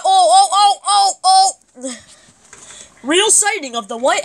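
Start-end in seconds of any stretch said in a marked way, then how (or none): 0.0-2.0 high, loud panicked oh oh; surprised cries breaking into a laugh; startled yelps. Real, not acted. Startled by a game mob.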